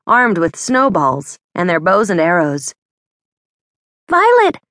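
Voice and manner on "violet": high, loud call across the street; calling a friend's name in alarm. A narrator's reading. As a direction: shout her name to get her attention. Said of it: "Hannah called out".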